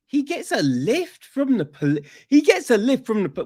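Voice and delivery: can't believe this plot point; high, baffled outburst; incredulous. Real, not acted. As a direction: pitch jumps high in disbelief.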